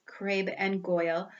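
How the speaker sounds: plain reading voice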